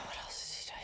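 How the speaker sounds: whispered aside to self; soft, sighing whisper; under the breath. Real, not acted. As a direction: think aloud in a whisper. Searching memory, aside to self.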